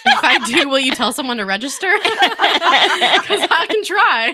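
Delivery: laughing through the words. Real, not acted. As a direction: giggle while answering. Laughing at auctioneer request.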